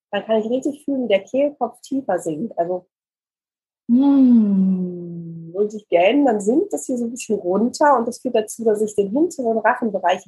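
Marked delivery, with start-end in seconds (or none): 3.9-5.5 long hummed yawn, gliding down; closed-mouth yawn. Shown as a demonstration. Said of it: "wenn ich mit geschlossenem Mund gähne". (Gähnen mit geschlossenem Mund)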